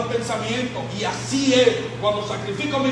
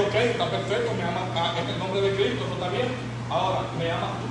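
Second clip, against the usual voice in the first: quiet challenge; 3 semitones lower, 7 dB quieter than usual. Retold or imitated. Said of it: "Y yo le digo".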